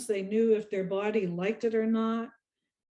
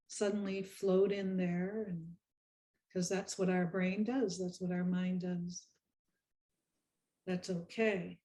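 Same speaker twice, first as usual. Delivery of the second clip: guided meditation. Acted. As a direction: lead them gently through each breath, unhurried.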